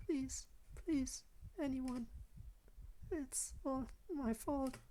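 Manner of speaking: put-on high, soft, halting girl's voice; frail plea with long gaps; fading Yuri. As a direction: whimper it one word at a time.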